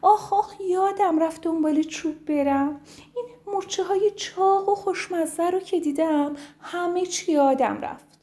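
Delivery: high, sheepish bird voice; high woodpecker admitting she forgot. Acted. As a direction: own up cheekily, mouth full. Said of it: "دید گفت".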